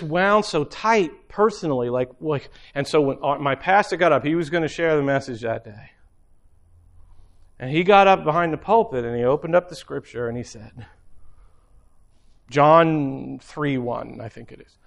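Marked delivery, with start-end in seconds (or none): none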